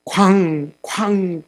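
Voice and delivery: hammer bang. Retold or imitated. Hammering nails into cross.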